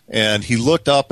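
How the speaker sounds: ordinary talk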